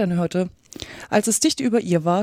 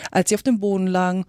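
ordinary delivery, nothing marked

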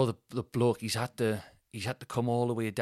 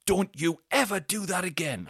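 high, raised, scolding warning; retold telling-off; 10 semitones higher, 4 dB louder than usual. Bloke pointing up at the culprit, scolding. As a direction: imitate a neighbour laying down the law. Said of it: "he's crouching and he's pointing".

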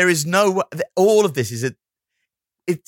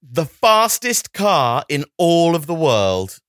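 slow, emphatic, raised proclamation; mock grand announcement; 4 semitones higher, 4 dB louder, much slower than usual. Co-host hailing the Alfa Romeo, slow mock-grand announcement. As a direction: draw it out grandly.

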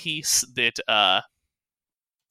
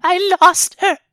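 high, loud, stammering creature voice; small frightened creature lamenting; distraught little kobold; 8 dB louder than usual. Acted. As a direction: blurt it out in a squeaky wail. Plays the kobold.

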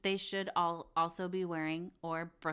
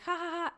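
high-pitched mock "ha ha ha"; put-on high laugh; 10 semitones higher, faster than usual. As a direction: imitate laughing along, spelled out. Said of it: "looks like she's laughing".

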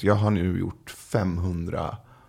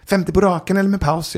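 high-pitched imitation of a kid; seven-year-old boy; 11 semitones higher, 3 dB louder than usual. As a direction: quiz him like a challenging little boy. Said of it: "så plötsligt så säger sonen". (härmad sjuåring med ljus röst)

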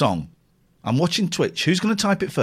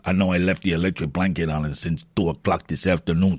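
low comic character voice; hammed-up low confiding voice; put-on character voice; 6 semitones lower than usual. Same line in put-on voice.

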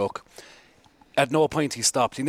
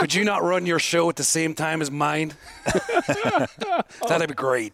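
lightly put-on voice with a laugh; guessing-game impression of a cheeky rival host; 4 semitones higher than usual. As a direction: ask cheekily in someone else's voice. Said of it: "Who do you think I am now?".

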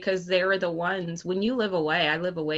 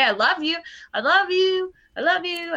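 much higher, louder sing-song I love you; chirpy repeated I love you; affectionate family; 11 semitones higher, 4 dB louder than usual. Retold or imitated. Family repeating love to the child, high and bright.

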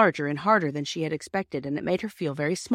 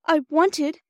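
put-on young girl voice; 10 semitones higher, 5 dB louder than usual. A narrator's reading. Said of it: "said Bobby".

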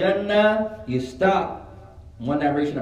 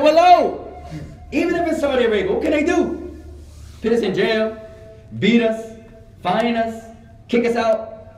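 raised, emphatic defiance; defiant retort; 6 semitones higher, 3 dB louder than usual. Retold or imitated. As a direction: toss out each consequence like a challenge. Retold defiant answer to warning.

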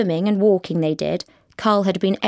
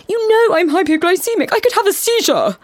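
high, louder whining protest; whiny excuse; 12 semitones higher, 5 dB louder than usual. A narrator's reading. Boyfriend insisting he'll have a seizure, high whiny protest. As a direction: overplay the poor sick boyfriend.

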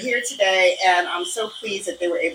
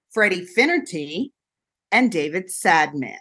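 usual speaking voice

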